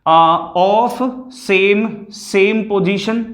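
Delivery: each word drawn out very slowly for notes; slow dictation. Shown as a demonstration. Teacher dictating to pupils, very slow and deliberate. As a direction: spell it out slowly so the kids can write. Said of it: "ये जो एक ही position".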